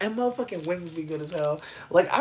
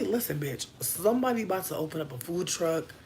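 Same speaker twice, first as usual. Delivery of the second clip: usual speaking voice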